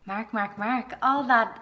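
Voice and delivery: loud, drawn-out calling of a name; excited calling. Real, not acted. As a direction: call him over, teasing and loud. Calling out to the guide.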